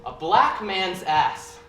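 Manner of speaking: punchline delivered slowly. Retold or imitated. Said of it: "and he says".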